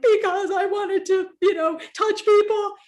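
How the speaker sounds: high-pitched plaintive retelling; mock wail. Wife retells pleading with her husband, mock wailing.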